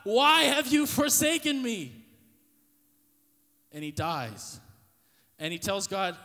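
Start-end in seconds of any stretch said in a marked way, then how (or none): 0.0-1.9 loud, slow, high cry to God; anguished cry. Retold or imitated. Dying Jesus crying out to God, anguished loud cry. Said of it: "he yells to God".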